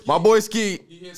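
usual speaking voice

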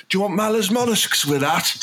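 high-pitched server voice; put-on order-taker's question; acted server. Acts out server taking order.

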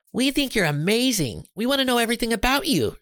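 Jenny gushing to the robot, high and quick; excited girl. A narrator's reading. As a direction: gush it out like an excited kid. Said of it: "she said".

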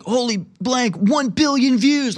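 high, breathless mock hype voice; mocking online activist. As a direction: ham up a gushing Twitter poster. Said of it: "he's like".

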